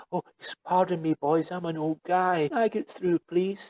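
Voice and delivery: lightly mocking a man giving his power away; meek pleading. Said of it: "You don't".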